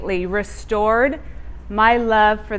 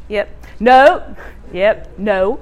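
teasing God voice; 3 dB louder, slower than usual. Retold or imitated. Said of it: "He's like".

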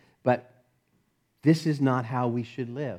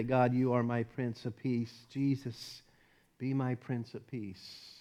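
hushed personal plea; soft prayer; 3 semitones lower, 11 dB quieter than usual. Pretend. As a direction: pray it softly to God. Voiced prayer.

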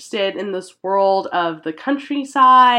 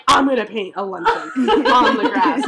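laughing Monet impression; 2 semitones higher than usual. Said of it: "He's like".

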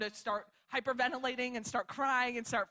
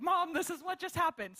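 higher-pitched retold call to Mom; imitated urgent call; 6 semitones higher than usual. Friend tells her mom about the accident, higher-pitched urgency. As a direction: voice a friend breaking news by phone.

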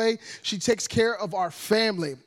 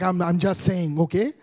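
put-on African mother's accent; 4 semitones lower than usual. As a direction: lower African-accented mum, backing off. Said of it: "She was like".

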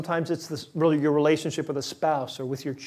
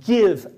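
"Give" punched higher and louder; "Give" as a firm, loud gift; stress on give; 5 semitones higher, 8 dB louder than usual. Shown as a demonstration. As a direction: hit "Give" hard. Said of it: "I'm the one who gives it".

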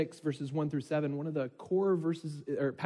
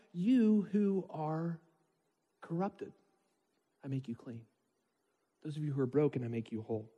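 tender voice of God; 4 dB quieter than usual. Acted. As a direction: draw it out gently, almost a whisper. God's tender words, very slow.

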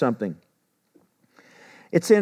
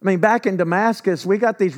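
higher, scoffing disbelief at the prophet; incredulous Naaman; 4 semitones higher than usual. Retold or imitated. Naaman scoffing at the prophet's order, incredulous. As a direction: play Naaman, stunned the prophet wants him in a river. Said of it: "and he's like".

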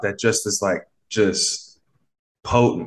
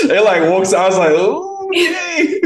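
high, laughing through the words; 4 dB louder, slower than usual. Real, not acted. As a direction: burst out laughing at the memory. Laughing at her own realization.

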